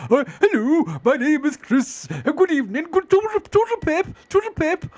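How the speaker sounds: high, hammed-up old-fashioned posh English voice; mock a 1920s Mary Poppins Englishman, way up high; imitating a bad posh British accent; posh English impression. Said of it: "that is about as un-Yorkshire as you get".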